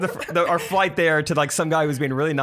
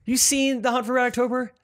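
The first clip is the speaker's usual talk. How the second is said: higher-pitched eager question; eager asker; 9 semitones higher than usual. Retold or imitated. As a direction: ask it high and keen, like someone quizzing a friend. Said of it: "anytime someone's like".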